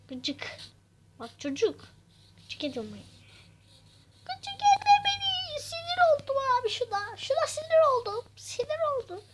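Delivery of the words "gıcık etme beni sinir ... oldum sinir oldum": much higher squeaky voice, mock irritation; squeaky annoyed voice. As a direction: squeal it out like a cartoon kid who's fed up. (çok ince, cırlak sesle sinirlenme)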